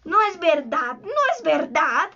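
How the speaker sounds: insistent, repeated denial; understated wild cry; mild savage shout. A narrator's reading. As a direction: deny it hotly, stretching the repeat. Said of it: "gritó salvajemente Garfio".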